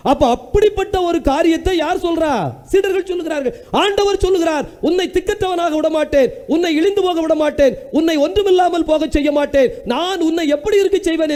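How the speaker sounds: high, driving preacher's voice, each line pushed up; the Lord's promise thundered from the pulpit; fiery preaching. Acted. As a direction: preach it with rising fervour. Preaching at full force.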